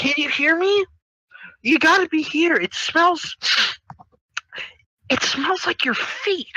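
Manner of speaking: reach out in a high, halting voice; hammed-up high kid voice; telepathic calling. Character calls out telepathically.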